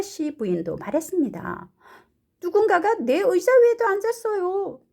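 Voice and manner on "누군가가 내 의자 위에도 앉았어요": high, loud character voice; raised storybook voice; indignant story mother. Acted. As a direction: act out mum's complaint. Mrs. Blair's line in translation.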